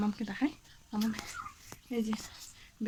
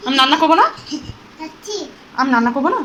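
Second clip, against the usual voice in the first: playful excited mock-scolding; 3 semitones higher, 14 dB louder than usual. Real, not acted. Mother playfully scolds dancing toddler.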